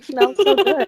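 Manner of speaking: a lightly mocking Megan swooning over her perfume; dreamy girl. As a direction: coo it like the perfume is heaven. Megan voiced in dream story.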